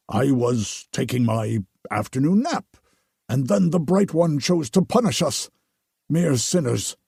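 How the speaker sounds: overly devout priest; pious priest voice. Acted. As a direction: gush with relief like a holy man. Narrator voices the Potter.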